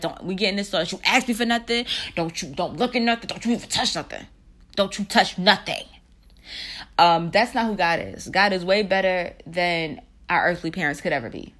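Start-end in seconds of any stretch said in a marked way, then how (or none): 0.0-5.8 lightly voiced stern mother in a store; a hint of a mother warning her child; scolding mother. Mother warning her kid in the store, mild scolding. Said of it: "the mother be like".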